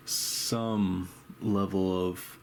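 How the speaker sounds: ordinary talk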